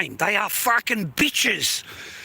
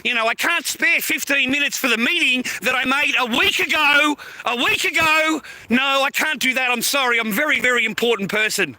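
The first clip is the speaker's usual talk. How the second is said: mocking, higher-pitched brush-off from a paid worker; self-important official; 4 semitones higher than usual. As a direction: mimic a pompous appointment-canceller, indignantly.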